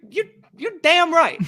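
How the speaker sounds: high, loud damn right; emphatic. Real, not acted. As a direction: punch it out proudly. Defending beer after cutting soda.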